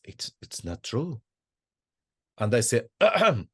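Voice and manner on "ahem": a pointed, raised ahem to interrupt; mock throat-clear. Student interrupting the sheikh's prayer with a pointed ahem. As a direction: clear the throat to get attention. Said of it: "in a voice".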